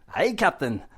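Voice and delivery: light mock Scottish accent; a rough, playful stab at a Scottish engineer; Scotty. Showing a favorite accent for self-talk, mock Scottish. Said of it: "the accent I like is Scotty".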